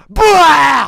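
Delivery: loud fake monster roar; jump scare yell. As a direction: pop out and bark it.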